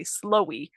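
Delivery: "slowy", L standing in for the N of snowy; student mispronunciation. Retold or imitated. Teacher quoting what a student says, "slowy" with L for N. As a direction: swap N for L to make "slowy". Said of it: "if you hear a student say slowy".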